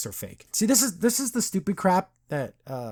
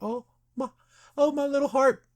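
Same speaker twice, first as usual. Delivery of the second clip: high-pitched mock swoon; touched, hammed-up; 10 semitones higher than usual. Host reacting to the sad brothers' parting, melodramatically touched. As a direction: coo it high, as if your heart melts.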